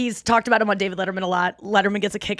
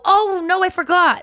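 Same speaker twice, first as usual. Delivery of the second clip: high, louder "oh, no" of a waiter; hammed-up oops, caught forgetting; fake surprise; 9 semitones higher, 5 dB louder than usual. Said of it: "and you go".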